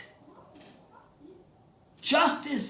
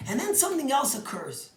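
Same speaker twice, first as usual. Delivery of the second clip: normal talking voice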